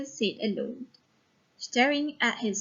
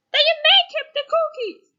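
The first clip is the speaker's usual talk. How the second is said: high, louder, fast angry snap; angry old woman; 6 dB louder, faster than usual. Shown as a demonstration. Angry old woman storming out on the baker. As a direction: fling it back at him in a huff. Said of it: "the woman is very angry".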